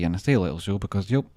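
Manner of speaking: ordinary delivery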